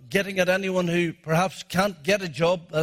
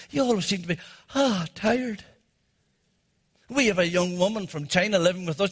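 ordinary talk, nothing marked

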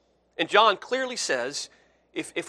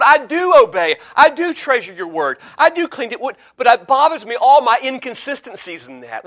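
pleading prayer; 6 semitones higher, 8 dB louder than usual. Pretend. Believer crying out for help with coldness of heart, pleading. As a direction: cry it out to God with longing.

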